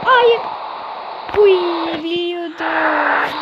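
loud high groaning no; frustrated shout. Real, not acted. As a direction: cry out in real dismay. Conceding a goal.

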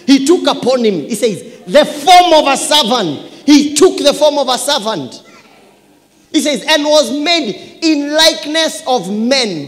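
high, loud preaching cry; full-force preaching. Real, not acted. Preacher proclaims the servant verse to church, full-force preaching.

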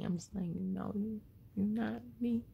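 normal talking voice

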